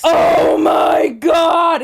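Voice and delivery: long, drawn-out high exclamation; exasperated outcry. Real, not acted. Host reliving the closet discovery, exasperated outcry. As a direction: stretch every word into a big outcry. Exclaiming over finding Gene.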